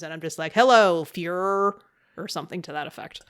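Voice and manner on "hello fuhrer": mock Tom Cruise. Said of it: "coming out like Tom Cruise".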